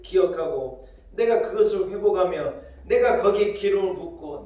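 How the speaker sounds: loud, slow, building sermon cry; fervent preaching. Acted. Pastor lifting the church with God's promise, full-force preaching.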